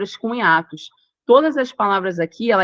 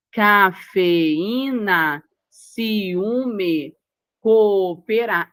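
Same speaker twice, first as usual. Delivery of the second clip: "Cafeína", "ciúme", "cooperar" very slow, split at every syllable; much slower than usual. Shown as a demonstration. Teacher reads the hiatus list, syllable by syllable. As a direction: draw out "Cafeína", "ciúme", "cooperar" with each vowel distinct. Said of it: "separação silábica de vogal e vogal".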